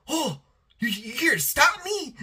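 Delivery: high, eager mock delight; excited villain. Megawatt meeting the Spider, giddy and excited. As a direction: greet the hero like a thrilled fan. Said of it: "he's all excited".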